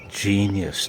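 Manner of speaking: ordinary delivery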